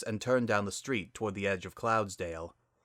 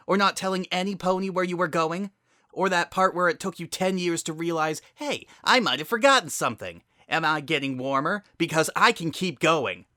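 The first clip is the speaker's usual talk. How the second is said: higher, louder, mocking tirade; sarcastic rant; 8 semitones higher, 5 dB louder than usual. Spitfire berating her sister, a higher, louder sarcastic rant. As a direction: pile the jabs on, rising.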